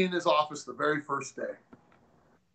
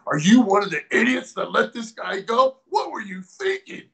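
retold scolding of other coaches, pitched up; mock scolding; 7 semitones higher than usual. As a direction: ridicule them for letting him go.

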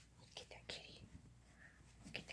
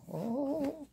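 surprised; 11 semitones higher, 8 dB louder than usual. Real, not acted. Cat pounces.

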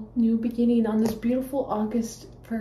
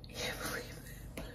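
whispered disbelief; hushed real whisper; awed whisper; 20 dB quieter, much faster than usual. Real, not acted. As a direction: breathe it out in wonder.

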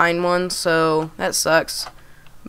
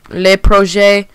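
hammed-up French and Spanish mix; slow, playful fake French-Spanish accent; mock French-Spanish accent; 4 dB louder, slower than usual. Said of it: "That was just French and Spanish at the same time".

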